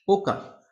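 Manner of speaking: ওকা, with the ai sound said as a clear o; teacher drills the vowel in ওকা as o, not e. Shown as a demonstration. French teacher showing students the o sound in ওকা. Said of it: "আ ই এর উচ্চারণ হয়".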